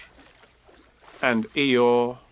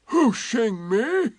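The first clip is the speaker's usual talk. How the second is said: slow, higher Eeyore surprise; drawn-out puzzled question; surprised; 9 semitones higher, much slower than usual. A narrator's reading. Eeyore surprised at being hooshed, slow and puzzled. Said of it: "said Eeyore in surprise".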